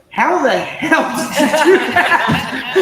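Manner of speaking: loud, high, laughing disbelief; retold incredulous outburst; amazed friend. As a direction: act out an astonished friend, drawn out into laughter.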